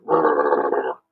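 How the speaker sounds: low growling rumble noise; stomach rumble. Shown as a demonstration. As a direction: rumble like a hungry tummy. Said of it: "make a rumble sound with me".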